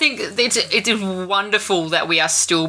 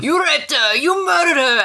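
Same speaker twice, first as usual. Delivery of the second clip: high-pitched mock accusing yell; imitated yelling; 10 semitones higher than usual. Wedding guests yelling out for the photos, imitated accusing cry. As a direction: mimic a dramatic accusation, pitched way up. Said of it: "some people would sort of be yelling out".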